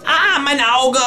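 high, loud yelp of pain; a shrill-free pained shout, pitched high; pained cry. A narrator's reading. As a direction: cry out as if poked in the eye. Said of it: "schreit das Känguru".

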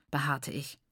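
ordinary delivery, nothing marked

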